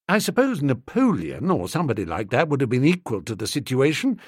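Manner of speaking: usual speaking voice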